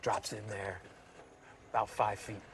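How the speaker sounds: hushed golf announcer whisper; golf commentator. Acted. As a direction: breathe the play call like TV golf. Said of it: "We're gonna do the whole golf guy thing".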